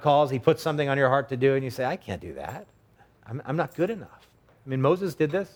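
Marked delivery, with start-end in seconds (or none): 1.9-4.3 softly mumbled self-doubt; acted reluctance, voice pulled back soft. Someone called by God begs off, softly self-doubting.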